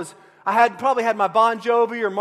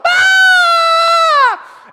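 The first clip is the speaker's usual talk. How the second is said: long, loud high-pitched mock scream; girlish scream; 12 dB louder than usual. Preacher re-enacting his crash for laughs, long girlish scream. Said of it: "I've screamed like a girl".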